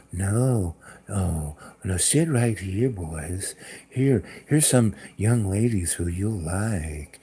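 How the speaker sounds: low, soft, coaxing imitation; smooth-talk the officers in a slow, hushed low voice; Jill. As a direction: impersonate a soothing host, quiet and unhurried. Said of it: "That's how Jill talked".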